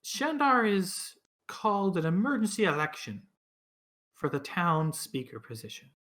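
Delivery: put-on weary old townswoman; tired old voice. Olivessa explains the election.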